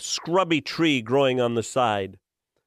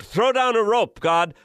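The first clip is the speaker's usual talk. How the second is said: man hanging on, yelling up to God; desperate yell; 9 semitones higher than usual. Acted. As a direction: shout up at the sky in a panic. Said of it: "The man yelled".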